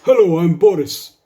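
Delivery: hammed-up Russian accent greeting. Goa waiter greeting a tourist in a mock Russian accent. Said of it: "with a Russian accent".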